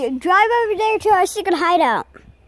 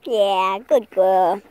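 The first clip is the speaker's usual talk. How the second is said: praising a dog; 10 semitones lower, much slower than usual. Real, not acted.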